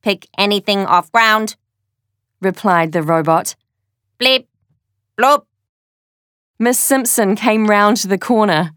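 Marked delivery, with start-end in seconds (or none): 0.0-1.6 robot voice. Acted. Replied the robot. 4.2-5.4 robot beeps. Acted. The robot's beeping noises.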